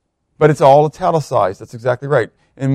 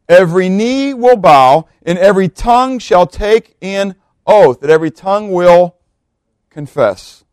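emphatic scripture reading; 4 semitones higher, 4 dB louder than usual. Acted. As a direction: proclaim each phrase with weight, stretched out. Reads scripture aloud with force.

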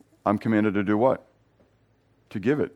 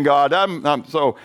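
ordinary talk

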